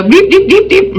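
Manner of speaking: high mock alarm beeping; alarm beeps. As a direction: beep like a warning alarm going off. Imitating an alarm going off.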